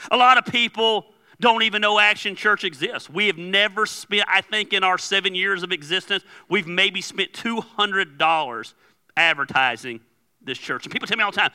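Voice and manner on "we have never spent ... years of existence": usual speaking voice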